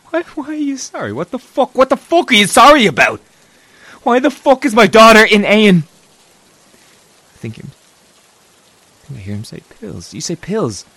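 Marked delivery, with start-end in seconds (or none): 0.1-5.9 much louder and higher, raging; furious shouting. Acted. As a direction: yell at the boyfriend demanding answers. Furious at boyfriend on phone.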